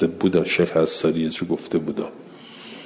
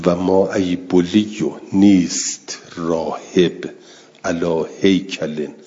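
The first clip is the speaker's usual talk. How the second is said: slow verse recitation; slower than usual. Shown as a demonstration. Teacher recites the verse again.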